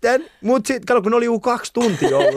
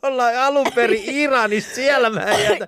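father's excuse; 6 semitones higher than usual. Retold or imitated. Voicing the father's reasoning.

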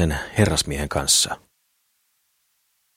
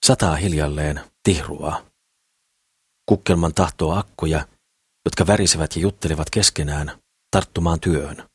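ordinary delivery

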